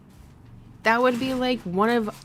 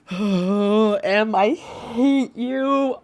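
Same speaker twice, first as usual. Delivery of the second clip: mock hate; 5 dB louder, much slower than usual. Real, not acted. Teasing friend over a joke.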